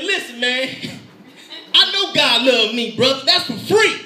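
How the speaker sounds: high, heated retold outburst; imitated yell. Panicking manager answers his comforter, imitated yelling. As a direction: yell back as the upset man, building. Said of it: "he kinda yelled at me".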